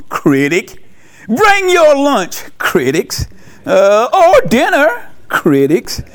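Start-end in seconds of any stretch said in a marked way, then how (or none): 1.3-2.4 higher, slow whiny complaint; mock critic. Mocking what critics say. 3.6-5.1 higher, slow whiny complaint; mock critic. Mocking what critics say.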